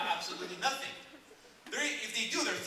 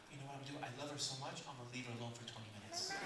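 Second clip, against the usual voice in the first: low, soft inner monologue; thinking to himself; 6 semitones lower, 8 dB quieter, faster than usual. Acted. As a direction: quietly work out a well-meant plan. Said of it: "He's thinking to himself".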